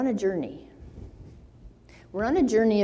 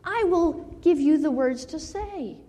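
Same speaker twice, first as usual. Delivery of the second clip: a lightly raised, commanding God voice; voicing God; 7 semitones higher than usual. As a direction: proclaim it as God, pitched higher. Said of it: "God's telling".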